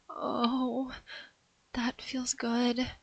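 slow, soft, whispered pleasure; woman whispering in pleasure; breathless whisper. Acted. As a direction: let it out on a breath. Pleasure, unvoiced.

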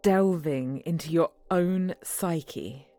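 slow, low hypnotist voice; hypnotic voice. Acted. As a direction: lull him into a trance, gently. Hypnotizing partner with phone app.

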